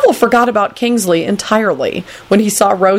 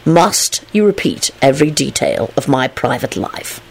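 slow, measured rebuke; weary exasperation; 3 semitones lower than usual. Acted. Lupin rebukes Teddy for tattling, slow exasperation. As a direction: drag it out in weary reproach.

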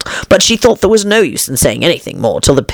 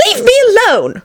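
high-pitched indignant protest. A narrator's reading. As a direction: push the accuser away, high and offended. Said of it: "said Alice indignantly".

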